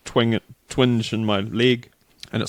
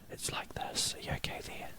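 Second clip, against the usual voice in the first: mock faint whisper over the headphones; imitating faint voices; 18 dB quieter than usual. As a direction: whisper it as if barely heard through the headphones. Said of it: "they're really faint".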